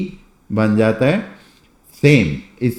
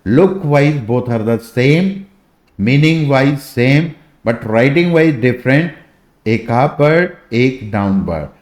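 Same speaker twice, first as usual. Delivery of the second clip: slow emphatic explanation; much slower than usual. Shown as a demonstration. Teacher stressing key point.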